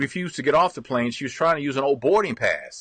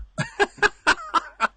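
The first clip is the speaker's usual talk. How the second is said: high laugh. Real, not acted. Laughs at garbled name.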